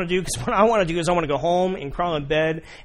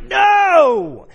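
shouted high NO; loud silent scream of no; inner no; 11 dB louder than usual. Acted. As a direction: blurt a loud refusal. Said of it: "In your mind".

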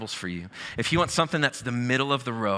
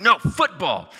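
louder, higher mock correction; insistent retort; 7 semitones higher, 6 dB louder, slower than usual. British friend insisting on his word to the pastor, mock-indignant. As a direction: push back hard in a playful argument.

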